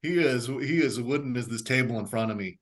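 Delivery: ordinary talk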